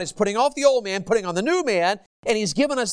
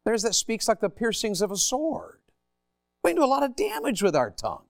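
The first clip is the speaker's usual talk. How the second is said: ordinary delivery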